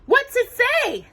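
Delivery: very high, slow mouse squeak; squeaky mice. A narrator's reading. The mice ask Cinderella about the letter, squeaky. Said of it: "they squeaked in unison".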